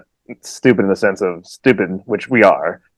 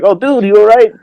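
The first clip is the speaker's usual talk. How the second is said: concerned stranger; 10 semitones higher, 4 dB louder than usual. Retold or imitated. Family asking after his fall.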